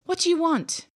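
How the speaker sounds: high, loud startled cry. Acted. Boy cries out at beast across the fire. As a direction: call out in alarm at the beast. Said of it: "John cried".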